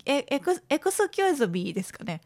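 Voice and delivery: ordinary talk, nothing marked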